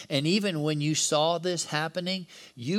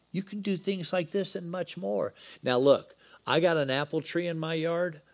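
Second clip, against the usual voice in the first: usual speaking voice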